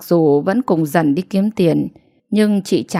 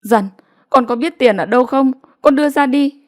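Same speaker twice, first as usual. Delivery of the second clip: urging mother; 6 semitones higher than usual. A narrator's reading. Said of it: "mẹ giục".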